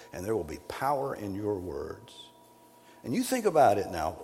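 usual speaking voice

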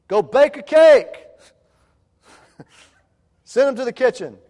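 parent's loud mock order to an unready daughter. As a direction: bark the order absurdly.

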